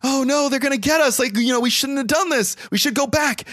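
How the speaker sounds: put-on high-pitched girl rabbit voice. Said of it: "the girl rabbit's like".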